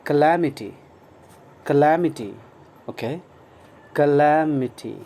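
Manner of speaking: calamity said loud and clear, stress on LAM; teacher's model word. Shown as a demonstration. Teacher drills calamity for learners, loud and clear. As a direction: repeat calamity carefully for the class. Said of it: "and its pronunciation is".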